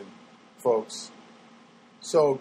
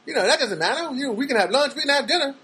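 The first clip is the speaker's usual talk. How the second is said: high, fast smooth pickup-line voice; mock ladies' man sweet-talk; smooth-talker impression; 8 semitones higher than usual. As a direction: play the slick guy hitting on her, quick and high. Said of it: "then he goes".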